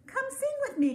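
high, bright call to a friend. A narrator's reading. Bird calling squirrel to sing, high calling out. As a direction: call up to him from the treetop. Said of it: "called Bird".

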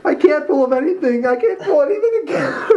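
very high, hammed-up tearful whine; crying Jed. Jed falls apart in rehearsal, mock crying. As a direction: blubber it helplessly, badly faked. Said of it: "stop crying".

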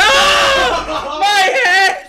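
very high, loud shriek; real panicked game yell; screaming in dismay. Real, not acted. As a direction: scream as if you were hit. Character is hit in game.

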